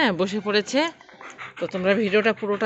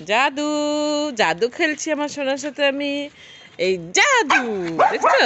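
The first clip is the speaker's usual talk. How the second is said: 1 mildly high pet talk; 6 semitones higher, 4 dB louder than usual. Real, not acted. Playing with pet, calling it.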